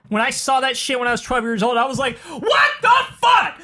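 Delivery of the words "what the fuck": high, drawn-out shocked shout; shocked yell. Retold or imitated. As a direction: yell it like a horrified kid, slowly. Retelling own shocked childhood reaction.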